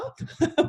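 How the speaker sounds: real amused laugh. Real, not acted. Teasing Jana about presenting, real laugh. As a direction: laugh at your own tease.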